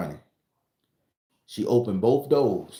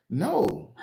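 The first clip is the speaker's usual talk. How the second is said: squeaky no; 10 semitones higher than usual. Retold or imitated. Refusing again, laughing.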